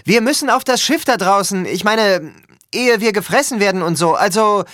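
high, loud, urgent appeal; shouting. A narrator's reading. Son explaining his plight to his father at sea, shouting. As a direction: call out to someone far away, pitched high. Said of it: "rief ich lauter".

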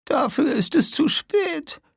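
put-on high, slow dying old woman; whispering. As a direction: breathe it out as she fades. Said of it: "wisperte sie". (geflüstert, sterbend)

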